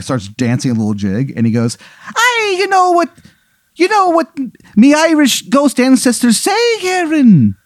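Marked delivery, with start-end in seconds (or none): 2.1-7.6 very high, hammy Irish brogue; overdone leprechaun Irish lilt; terrible Irish accent. Acted. Dancing leprechaun ghost quizzing Aaron, terrible Irish accent. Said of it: "His, his accent is terrible".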